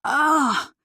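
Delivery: high frustrated groan; a sharp annoyed ugh; exasperated ugh. A narrator's reading. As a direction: let out pure exasperation. Annie's exasperated cry.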